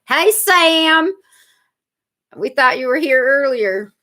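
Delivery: high, drawn-out greeting in a silly voice; goofy high-pitched character hello; put-on character voice. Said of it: "just in a different voice".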